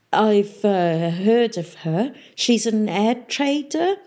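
hesitant, slow, carefully innocent reply; hiding happiness. Acted. Boy hiding his joy from the pirate mayor, careful innocence. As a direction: feign ignorance and sound casual. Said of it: "tried to hide his happiness".

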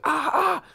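high-pitched mock "ah, ah" scream. Imitating CG people screaming in movie.

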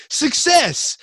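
high, loud cheer; retold triumph. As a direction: proclaim it in mock triumph.

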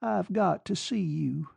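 a shaky, held-back voice; unsteady voice. A narrator's reading. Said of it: "came an unsteady voice".

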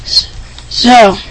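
ordinary talk, nothing marked

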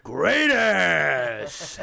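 sudden loud bellow on "great ass"; Al Pacino. Acted. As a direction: start contained, then explode into a long drawn-out holler. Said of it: "That's my Pacino".

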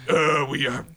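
loud, gruff warlord growl; rough hammed-up tyrant; Lord Humongous voice. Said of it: "the best Humongous I could do".

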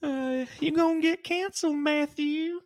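high squeaky teasing voice; mock high teasing. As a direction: tease him in a high voice.

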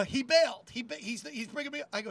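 ordinary talk

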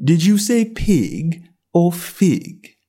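slow, drawled lazy cat; snooty bored cat. A narrator's reading. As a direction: drawl it out, unimpressed. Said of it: "said the cat".

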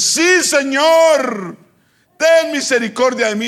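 loud, high, long-held shout; crying out. Retold or imitated. Woman still calling to the silent Lord, crying out. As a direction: keep calling after him, high and loud. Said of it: "seguía dando voces".

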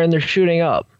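normal talking voice

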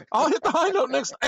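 high, laughing squeal. Real, not acted. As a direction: talk through a laugh. Laughing at the joke.

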